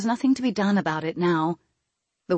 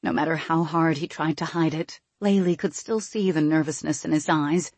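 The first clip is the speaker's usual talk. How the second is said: ordinary delivery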